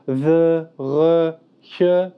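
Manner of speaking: "the" said with the Greek th of theta, "re" with a tapped Greek r, "che" with the throaty Greek ch of chi; slow, separate demo of Greek "the", "re", "che"; Greek sounds demo. Shown as a demonstration. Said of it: "quelques sons qui sont un peu différents".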